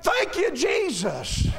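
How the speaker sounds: high cry falling away at the end; mock congregational outburst; imitated praise call. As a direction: exclaim it like a grateful churchgoer. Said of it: "Somebody would say".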